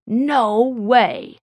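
flat-out refusal, snapped loudly and high. A narrator's reading. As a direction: snap back at full volume. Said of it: "Bill snapped".

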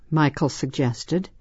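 ordinary delivery, nothing marked

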